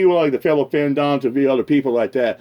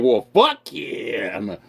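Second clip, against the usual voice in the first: slow dismissive retort; 5 semitones lower, 3 dB quieter, much slower than usual. Retold or imitated. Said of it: "and I'm like".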